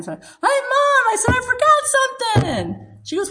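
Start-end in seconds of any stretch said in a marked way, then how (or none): 0.1-1.3 high, loud, cheery call; put-on cheerfulness. Daughter returning to hug Mom goodbye, put-on cheerful call. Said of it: "I gotta be cheerful". 1.4-3.0 high-pitched, cheery retold call; put-on cheerfulness. Daughter back at her mother's door, put-on cheerfulness. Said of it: "I gotta be cheerful".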